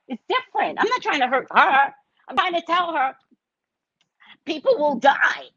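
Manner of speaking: high-pitched tearful pleading; retold crying. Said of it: "so I started crying".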